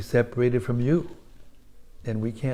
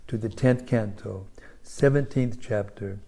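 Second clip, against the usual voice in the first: scripture reading; 6 dB louder than usual. Acted. Preacher reading the verse aloud to the congregation, solemn. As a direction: proclaim the holy text to the whole hall.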